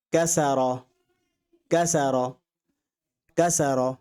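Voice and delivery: কাসারা said in Arabic, each syllable clear; teacher repeats কাসারা three times; Arabic pronunciation. Shown as a demonstration. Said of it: "আমি আরবি বলছি". (আরবি উচ্চারণে কাসারা)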